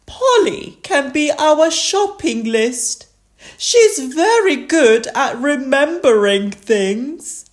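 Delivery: high, proud granny voice; Granny Pig. A narrator's reading. Granny Pig beams at her parrot, high and proud. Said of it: "Granny Pig said, beaming at Polly".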